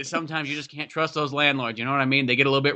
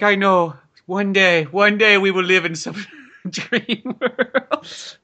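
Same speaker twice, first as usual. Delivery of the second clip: higher, drawn-out father voice; dreaming papa; 5 semitones higher, much slower than usual. Pretend. Landlord promises his boy a better life, mock wistful. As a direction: promise it grandly, stretching it out. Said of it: "He's like".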